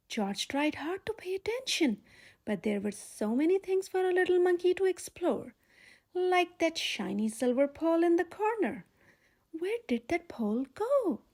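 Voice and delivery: usual speaking voice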